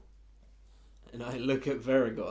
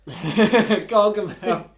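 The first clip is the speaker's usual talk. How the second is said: high, loud, drawn-out yell; laughing mock shout; 10 semitones higher, 16 dB louder, much slower than usual. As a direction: yell it slowly through laughter. Players shout out villain's name.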